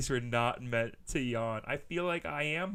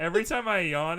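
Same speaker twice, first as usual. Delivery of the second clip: excited; 6 semitones higher, 9 dB louder than usual. Real, not acted. Excited joke.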